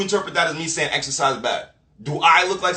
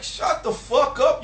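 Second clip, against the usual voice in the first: ordinary delivery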